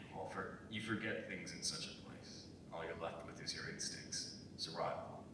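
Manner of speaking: low and hushed, trailing off; subdued quiet. Acted. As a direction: let the memories pull the voice down to almost nothing. Old soldier recalling war, subdued.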